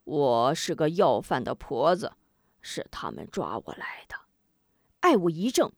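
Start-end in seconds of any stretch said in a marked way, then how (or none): 0.0-4.2 put-on old beggar woman. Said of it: "老妇道".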